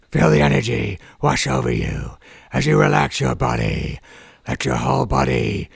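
hoarse superhero as relaxation guide. Acted. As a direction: rasp out a meditation like a dark hero.

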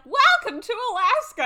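high, booming retold welcome; exaggerated high holler, quoting a character; mock excited shout. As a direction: belt it out as a mock big welcome. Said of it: "she's like".

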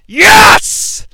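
thrilled high yell of joy; excited shout. Real, not acted. Fan cheering the movie catchphrase, excited shout. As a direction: shout it, delighted the line came.

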